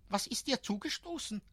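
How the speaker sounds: put-on high, quick curious child's voice; a small boy checking on a friend; little boy asking. A narrator's reading. Boy asks the sad postman.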